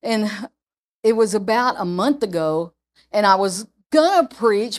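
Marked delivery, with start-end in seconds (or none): none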